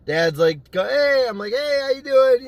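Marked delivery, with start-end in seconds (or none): 0.9-1.3 dad's greeting. Retold or imitated. Dad greets him on entering. 1.5-2.5 high, loud, over-casual greeting; imitated nervous cover-up hello, pitched up; feigned innocence. Acts innocent, hiding hole from dad.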